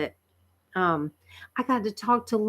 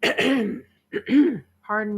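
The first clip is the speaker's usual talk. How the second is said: throaty, clearing throat; frog in throat; 4 semitones higher than usual. Real, not acted. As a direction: talk past a frog in the throat. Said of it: "I have a frog in my throat".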